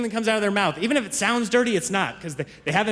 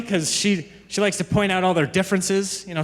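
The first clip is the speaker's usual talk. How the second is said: normal talking voice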